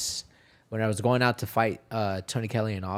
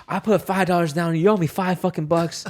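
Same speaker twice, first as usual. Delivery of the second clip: raised, higher voice of a bettor who wants his money; aggrieved gambler, pitched up and louder; angry bettor; 7 semitones higher, 4 dB louder than usual. Retold or imitated. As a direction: demand the five bucks back, worked up.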